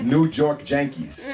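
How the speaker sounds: "New York Yankees" with Y turned to a J sound, slow. Shown as a demonstration. Mocking where Spanish speakers put the J, Y as J. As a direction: say "Yankees" as "Jankees", drawn out. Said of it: "They just put it where they wanna put it".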